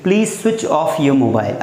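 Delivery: teacher modelling a polite notice; slow polite request. Shown as a demonstration. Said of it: "तो आप बोलोगे".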